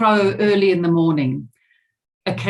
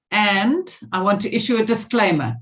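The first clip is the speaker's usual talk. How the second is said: ordinary talk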